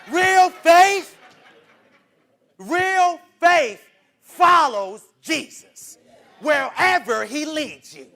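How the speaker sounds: loud, drawn-out preaching shout. Real, not acted. Preacher to congregation, full-force drawn-out shout. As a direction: cry it out to the church at full force.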